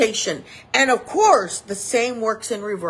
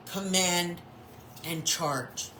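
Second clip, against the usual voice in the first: ordinary delivery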